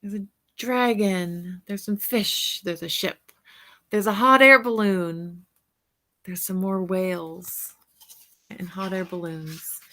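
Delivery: usual speaking voice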